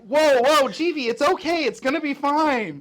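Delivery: high, very loud calming shout; urgent reassurance. Acted. Companions to panicking Jeevee as the spell goes off, urgent loud reassurance. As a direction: talk a panicker down at full volume.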